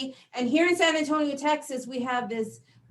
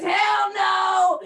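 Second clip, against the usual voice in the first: high loud "hell no"; defiant yell; 8 semitones higher, 7 dB louder than usual. Retold or imitated. Teenage son shouting back at mom, high and loud. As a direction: son yells it, pitched up. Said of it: "he says".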